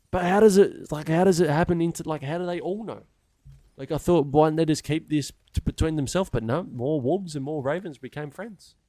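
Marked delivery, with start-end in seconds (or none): 0.1-6.3 baffled outburst. Real, not acted. Loud wondering rant.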